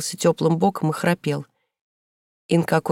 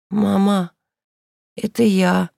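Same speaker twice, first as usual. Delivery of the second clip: hoarse; 2 semitones higher, slower than usual. A narrator's reading. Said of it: "ответила Инка хриплым голосом".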